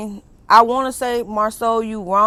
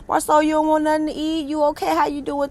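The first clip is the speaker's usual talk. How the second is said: higher, gentle checking-in voice; a hint of a caring friend; concerned friend; 7 semitones higher than usual. Voicing Mel checking on him.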